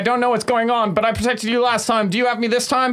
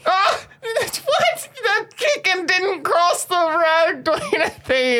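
being tickled; 7 semitones higher, slower than usual. Acted. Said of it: "crippling laughter".

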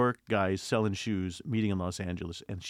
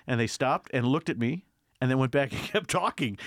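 usual speaking voice

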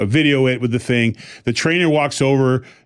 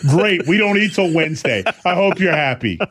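mock-indulgent offer, pitched up, then a verdict; lightly hammed-up telling-off of yourself; scolding self-talk; 6 semitones higher than usual. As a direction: tease yourself with treats, then deny them. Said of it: "That's how I talk to myself".

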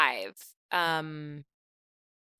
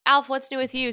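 normal talking voice

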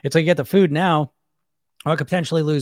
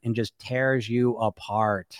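ordinary talk

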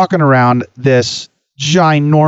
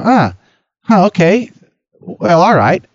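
higher, halting, imitated polite uncertainty; hesitant acceptance; 5 semitones higher than usual. Vendors being introduced to Bob, imitated hesitant acceptance. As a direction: go along with it, mildly unsure.

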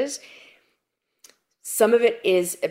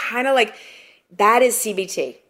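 usual speaking voice

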